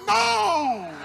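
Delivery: a high mock yell of horror. Burglars yelling back at the ugly woman, high mock yell. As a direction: yelp it out high, in mock terror. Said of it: "They yelled".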